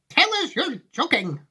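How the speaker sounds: put-on high, pleading old lady; Grandma Josephine. Grandma begging Wonka for reassurance, high and pleading. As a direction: beg in a high granny voice. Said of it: "said Grandma Josephine".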